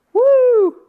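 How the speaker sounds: very high, loud woo; excited whoop. Real, not acted. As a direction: cheer like the heavenly host.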